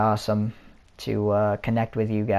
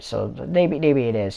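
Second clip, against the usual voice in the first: ordinary talk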